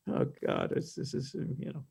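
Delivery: a sleepy narrator nodding off; sleepy mumble. Retold or imitated. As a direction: mumble it half asleep. Sleepy in studio, retold thought.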